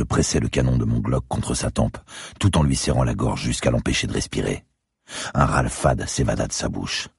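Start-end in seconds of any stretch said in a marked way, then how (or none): none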